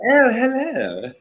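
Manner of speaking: high, slow mock-posh greeting. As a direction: drawl it like a toff. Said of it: "He was like".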